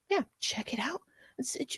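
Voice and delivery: unvoiced whispered plug. Real, not acted. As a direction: breathe it out like a secret. Sign-off plug after sponsor thanks.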